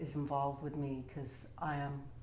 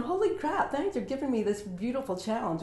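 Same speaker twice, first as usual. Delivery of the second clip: much higher, louder, delighted; excited exclaim; 7 semitones higher, 5 dB louder than usual. Retold or imitated. Thanking life for her cancer diagnosis, excited and high. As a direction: burst out with joyful disbelief. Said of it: "I'm like going".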